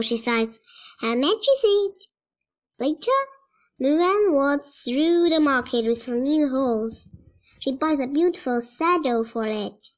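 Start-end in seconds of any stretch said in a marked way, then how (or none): none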